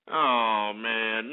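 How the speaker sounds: ordinary talk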